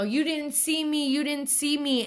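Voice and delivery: half-relived panicked shout, voice up high; retold frantic yelling. Yelling at her brother after the robbery, frantic. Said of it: "screaming bloody murder at my brother".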